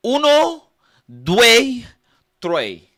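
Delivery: high loud yelped count; mock Italian counting. Comic counting in Italian, high loud yelps. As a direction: squeal the numbers, falling at the end.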